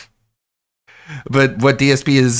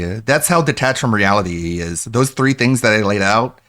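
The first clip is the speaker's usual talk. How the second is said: ordinary talk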